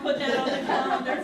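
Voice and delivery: laughing loud echo. Real, not acted.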